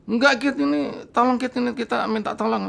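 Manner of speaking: high pleading voice; pleading friends. Retold or imitated. Frightened friends begging him to come help, high pleading.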